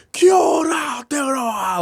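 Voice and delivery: high shouted greeting, falling at the end; hype man shout. Retold or imitated. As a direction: call it out to the crowd. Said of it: "like that".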